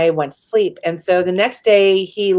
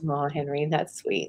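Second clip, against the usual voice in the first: soft aside; 4 semitones lower, 11 dB quieter than usual. Real, not acted. Soft aside to someone nearby.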